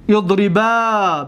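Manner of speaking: اضربا drawn out slowly, idribaa with a long final a; slow Arabic demo. Shown as a demonstration. Teacher giving the dual imperative to students, slow and clear. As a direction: stretch اضربا and let the final alif ring.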